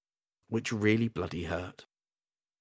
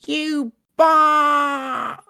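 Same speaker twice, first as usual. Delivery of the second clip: high cut-off goblin scream; 10 dB louder than usual. Acted. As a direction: scream in pain, cut off mid-word. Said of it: "The scream tore itself from Scab's lips".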